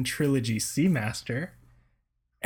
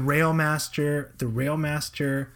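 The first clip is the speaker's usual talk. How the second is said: slow emphasis; 3 semitones higher, slower than usual. Real, not acted. Drawn-out emphatic naming.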